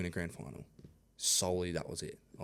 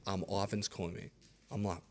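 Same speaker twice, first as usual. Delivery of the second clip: ordinary talk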